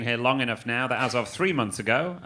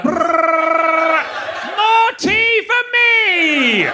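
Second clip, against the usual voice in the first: very high, very loud, slow battle shout; crazed soldier; 9 dB louder, much slower than usual. Pretend. Comic as a soldier gleefully shooting British people. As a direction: scream it like a gleeful maniac charging.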